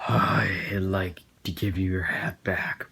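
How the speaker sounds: slow whisper up close; ominous slow whisper leaning in; creepy whisper. Retold or imitated. Teacher whispering close in face.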